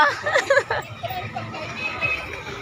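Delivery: high laugh trailing off softly; real giggling burst, fading away. Real, not acted. Teasing chat with customers. (matinis na tawang humihina)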